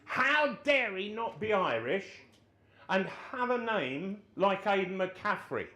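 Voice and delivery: high, loud, slow indignant voice; outraged manager. Retold or imitated. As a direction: spit out the outrage slowly. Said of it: "he says".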